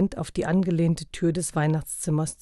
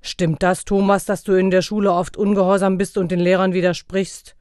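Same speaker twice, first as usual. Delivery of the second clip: loud, stern questioning; stern Santa; 3 semitones higher, 7 dB louder than usual. A narrator's reading. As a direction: interrogate the boy.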